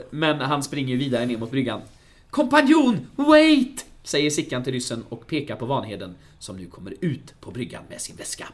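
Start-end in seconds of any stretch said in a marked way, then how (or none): 2.3-3.8 high, loud, urgent shout; calling out. Acted. Sickan shouting to the Russian in the film retelling, urgently. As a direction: yell it after someone to stop them.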